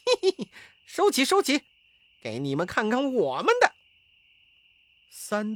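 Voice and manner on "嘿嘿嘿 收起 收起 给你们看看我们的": very high, loud, fast giggly taunt; giggle, then rattle it off like a cocky monkey; high, rapid, mocking monkey character; laughing monkey voice. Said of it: "便笑着说".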